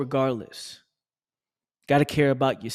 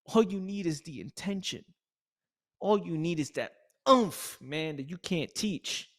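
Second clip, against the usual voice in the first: normal talking voice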